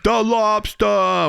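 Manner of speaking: slow and high, Boston lobsta; drawn-out Boston "lobster" without the r; Boston accent. Shown as a demonstration. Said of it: "we're in Boston".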